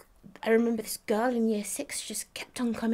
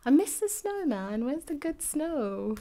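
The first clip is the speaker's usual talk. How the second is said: high, louder pining for snow; mock-wistful cry; playful longing; 8 semitones higher, 4 dB louder than usual. Real, not acted. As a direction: whine it like you really miss it.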